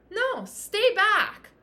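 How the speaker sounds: coach's emphatic call; firm command. Real, not acted. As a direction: plant your feet and order them to stay. Coaching the viewer firmly.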